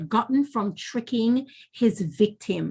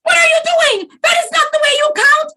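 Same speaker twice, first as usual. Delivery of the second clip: furious high shout, fast at the end; angry shout; 6 dB louder, faster than usual. A narrator's reading. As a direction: explode at her in outrage. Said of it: "Anansi shouted angrily".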